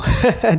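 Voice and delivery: short high mocking laugh; teasing laugh. A narrator's reading. Lalu laughs at friends hurrying to college, teasing chuckle. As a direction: chuckle at them in jest. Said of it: "হেসে তামাশা করে বলতো".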